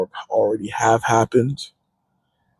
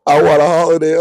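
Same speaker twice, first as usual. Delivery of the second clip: normal talking voice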